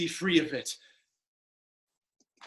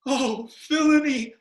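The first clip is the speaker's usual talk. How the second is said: ordinary delivery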